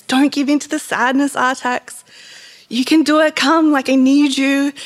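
retold anxious plea; distressed pleading. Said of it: "Atreyu's getting more and more distressed".

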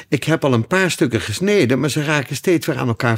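fretful, annoyed explanation; exasperated Alice. A narrator's reading. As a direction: protest in frustration, pitch up. Alice frustrated with the cake.

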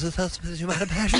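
quick mocking imitation of a winded intern; imitating the intern. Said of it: "he goes".

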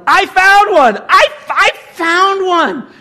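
very high, loud, drawn-out triumphant yell; excited yell. Retold or imitated. Husband retells finding a ruby, yelling in triumph. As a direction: holler it slowly to the whole crowd. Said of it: "And I yelled".

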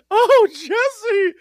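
high, loud mock swoon; swooning admirers. Host imitating women falling for his voice, swooning admirers. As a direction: squeal like a smitten fan. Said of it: "They'd be like".

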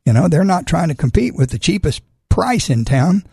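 normal talking voice

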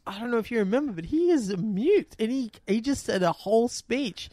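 high, hammed-up incredulity; mock disbelief, pitched well up; Giovanni Ribisi. Podcaster stretching out the actor's needless reminder, Giovanni Ribisi impression. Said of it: "it cuts to Giovanni Ribisi".

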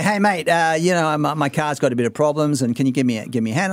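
casual, matey broad Aussie accent; broad Australian accent. Shown as a demonstration. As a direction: ask a mechanic for help in broad Australian. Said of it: "I'll be going".

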